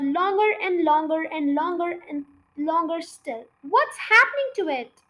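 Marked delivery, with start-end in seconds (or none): 3.6-5.0 louder, higher, worried question; alarmed cry. A narrator's reading. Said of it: "cried Mister Killy Cranky".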